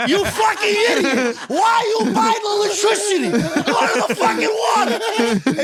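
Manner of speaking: high, laughing dad rant; mock furious dad through laughter; laughing mock angry dad. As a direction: yell it while cracking up. Dad berating son, laughing teller.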